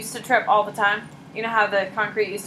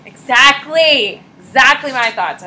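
loud, raised, drawn-out exclamation; retold emphatic agreement; 4 semitones higher, 8 dB louder, slower than usual. Teacher quoting a companion's reply, emphatic agreement. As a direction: imitate someone agreeing with gusto.

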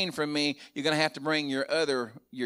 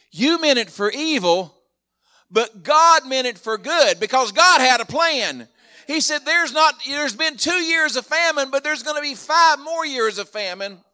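much louder, higher impassioned proclamation; full-force preaching; 10 semitones higher, 7 dB louder than usual. Acted. Pastor driving home God's plan, full-force preaching. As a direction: proclaim it to the congregation with fire.

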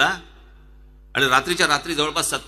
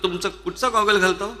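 quoting the seller; 6 semitones higher than usual. Retold or imitated. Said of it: "मला म्हणतो".

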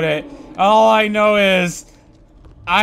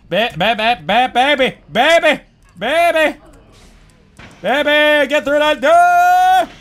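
building cheer; 5 semitones higher than usual. Real, not acted. Gamer urging the monster through the door. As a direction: cheer it on harder each time.

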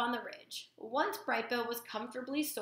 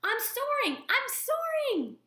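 high, slow, joyful gosling cry; shouting for joy while airborne; squeaky thrilled cheer; excited squeak; 5 dB louder, slower than usual. Acted. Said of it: "he squeaked".

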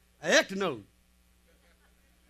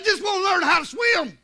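raised, higher-pitched pleading; insistent boy; 7 semitones higher, 4 dB louder than usual. Retold or imitated. Boy in the boat to his dad, acted loud insistence. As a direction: insist loudly like a pestering kid.

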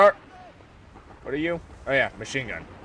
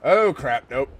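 ordinary talk, nothing marked

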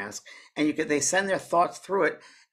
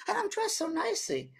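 very high, softer lady imitation; Scottish lady; 6 dB quieter than usual. Spirit lady marvelling at her clothes, high soft put-on voice. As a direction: play the lady in a high light voice. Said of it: "a lady from Scotland".

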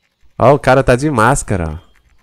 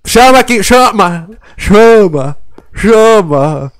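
shouting; 8 semitones higher, 6 dB louder, slower than usual. Real, not acted. Calling for attention in game.

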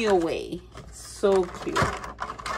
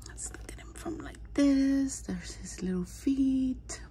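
hushed whisper; 4 semitones higher, 6 dB quieter than usual. Real, not acted. Collector showing viewers the tiny feet, hushed whisper. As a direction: whisper as if not to wake him. Examining the toy closely.

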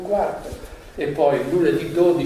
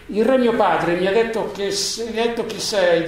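higher and louder, mildly indignant; 6 semitones higher, 4 dB louder than usual. Acted. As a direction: confront him with mild indignation. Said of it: "disse il principe indignato".

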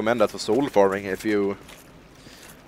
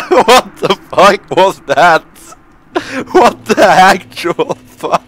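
loud, high, slow exclamation; incredulous, through real laughter; laughing disbelief; 6 semitones higher, 10 dB louder, slower than usual. Real, not acted. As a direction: crack up while crying out in disbelief.

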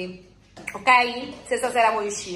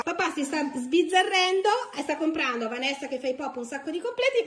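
ordinary delivery

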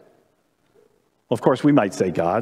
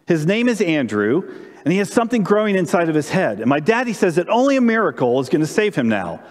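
put-on higher child voice, earnest and pleading; high girlish voice; little girl; 4 semitones higher than usual. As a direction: play an eight-year-old girl begging. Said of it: "Tess, being eight".

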